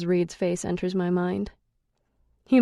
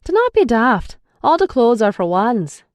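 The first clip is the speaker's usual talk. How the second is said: louder, exasperated Irish man; incredulous; 5 semitones higher, 8 dB louder than usual. A narrator's reading. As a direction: tell her she's daft. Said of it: "he replies like I'm crazy".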